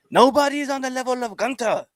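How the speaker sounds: mock Gunther boasting in a high Austrian accent; Gunther impression, Austrian accent. Podcast host mimics Gunther bragging, in a high Austrian accent. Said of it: "I don't know if that's a perfect accent".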